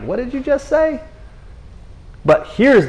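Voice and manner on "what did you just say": high, incredulous gasp of a question; much higher, caught completely off guard; stunned disbelief. Acted. Disciples stunned by Jesus' claim.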